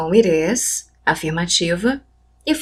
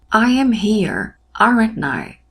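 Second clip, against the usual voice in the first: slow model English; slower than usual. Shown as a demonstration. Teacher modelling English example.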